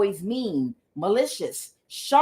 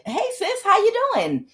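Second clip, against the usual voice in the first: high, singsong hello; friendly greeting; 12 semitones higher than usual. Retold or imitated. Showing how she wishes her sister greeted her, friendly. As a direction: imitate a sweet, eager greeting.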